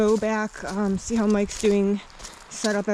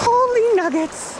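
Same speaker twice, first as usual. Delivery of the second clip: stamping feet for warmth; 11 semitones higher, 5 dB louder than usual. Real, not acted. Icy water.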